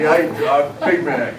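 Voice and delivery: curt and unfriendly, a nervous laugh; grudging two-word order with a laugh; cold curt order. Retold or imitated. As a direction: mumble the order coldly. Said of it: "give this old, nice old lady, uh, this angry stare".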